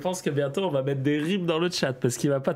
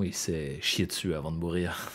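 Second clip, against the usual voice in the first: documentary voice-over; 7 semitones lower, 6 dB quieter than usual. Shown as a demonstration. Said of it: "Un faux documentaire comme ça".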